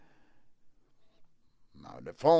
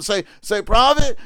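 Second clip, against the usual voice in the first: high, loud cry of Prophet; loud preaching call-out; preacher's call; 6 semitones higher, 6 dB louder than usual. Real, not acted. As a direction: rouse the crowd to answer back. Urging listeners to call out.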